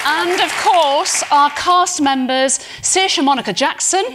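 loud, bright panel roll-call, names ringing out; announcer introduction. Acted. Host introducing the panel to the festival crowd, announcer voice. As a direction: present each name to the room like a host.

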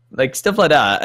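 ordinary talk, nothing marked

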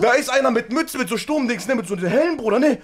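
ordinary talk, nothing marked

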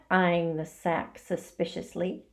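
plain reading voice

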